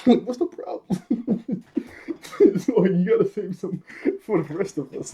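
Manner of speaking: high, slow laughing through the words; amused squeal while talking. Real, not acted. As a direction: giggle your way through the complaint. Laughing at Yumi's line.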